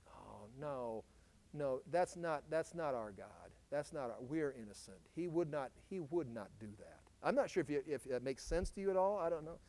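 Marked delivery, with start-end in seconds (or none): 0.1-7.0 soft, low imagined objection; hushed mock denial. People denying God would judge them, hushed and low. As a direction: quietly protest as a disbelieving listener. Voices listeners refusing to believe.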